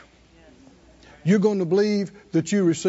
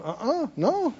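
high, lightly acted protest of innocence; imitated defensive denial, pitched up; mock denial; 8 semitones higher than usual. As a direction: play a crowd disowning it. Acts out crowd denying touch.

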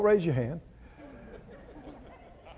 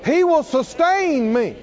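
fervent declaration; 5 semitones higher, 3 dB louder than usual. Shown as a demonstration. Congregational declaration modeled.

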